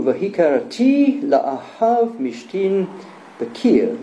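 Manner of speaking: "Vahikarati la'ahav mishtin bakir" read slowly and carefully in Hebrew; Hebrew quotation. Shown as a demonstration. Lecturer quoting Second Kings to the audience, slow careful Hebrew. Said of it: "it goes in Hebrew".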